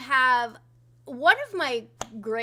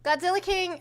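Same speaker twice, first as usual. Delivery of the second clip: normal talking voice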